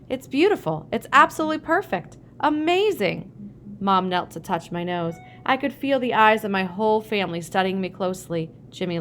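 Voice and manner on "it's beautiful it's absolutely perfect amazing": amazed. A narrator's reading. Dad hamming up amazement.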